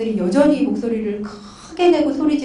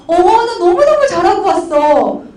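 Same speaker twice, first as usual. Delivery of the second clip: loud, high, drawn-out boasting; mock proud boast; 6 semitones higher, 7 dB louder, slower than usual. Wife boasting to her husband after a lecture, loud mock pride. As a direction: play up the pleased self-congratulation.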